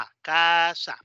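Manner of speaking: A as open "ah", then casa stretched out; teacher spelling casa by its sounds; sounding out. Shown as a demonstration. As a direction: blend into casa with long vowels. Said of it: "Y luego la última A".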